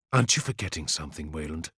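low, quick, tight anger; fast, hushed, cutting question; snapped reproach. A narrator's reading. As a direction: snap it under restraint. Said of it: "he snapped".

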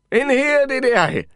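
high, loud shouted reply; shouting back. Acted. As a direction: shout it across the building. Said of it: "I shouted back".

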